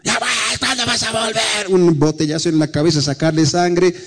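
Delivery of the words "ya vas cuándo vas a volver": higher, softer, gentle asking; gentle plea. Retold or imitated. Mother asking her drunk son, soft tender plea. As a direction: coax him home tenderly. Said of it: "No le grité".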